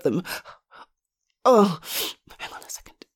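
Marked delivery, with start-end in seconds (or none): 2.4-3.1 barely audible whisper; hushed aside. Acted. Nurse pausing the call with husband, whispered aside.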